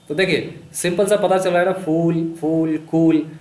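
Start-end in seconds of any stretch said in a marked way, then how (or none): 1.7-3.3 फूल, फूल, कूल one by one, vowel held long; stressed vowel. Shown as a demonstration. Teacher showing the repeated vowel, फूल and कूल held long. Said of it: "ऊ वाली sound जो है वो repeat हो रही है".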